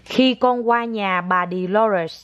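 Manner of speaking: put-on very slow, word by word, for a child to repeat; slow model phrase. Shown as a demonstration. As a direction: stretch each word out as a lesson. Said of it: "con nói". (mẹ nói thật chậm cho con lặp lại)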